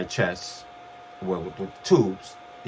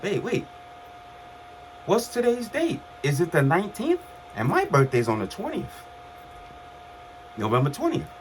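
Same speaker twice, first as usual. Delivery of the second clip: startled realisation; 9 semitones higher than usual. Retold or imitated. Realising birthday is tomorrow.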